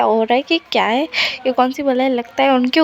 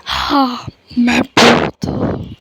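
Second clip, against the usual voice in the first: slow heavy disguised deep voice; much slower than usual. Pretend. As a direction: boom it out slow and heavy like a ghost. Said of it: "एकदम भारी आवाज़ में बोलता है".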